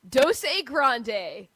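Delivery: loud teasing quote of Doce Grande; mocking imitation. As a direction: bellow it back to tease him. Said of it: "we heard you this morning when you said".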